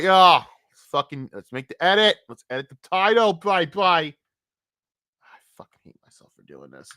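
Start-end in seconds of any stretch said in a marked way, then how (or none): 5.2-6.9 grumbling under the breath; low mutter. Real, not acted. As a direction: say it to yourself, much softer and lower. Muttered self-reproach.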